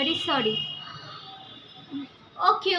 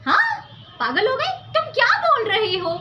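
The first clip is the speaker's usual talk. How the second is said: indignant disbelief; 8 semitones higher than usual. Acted. Mother indignant at soldier's claim.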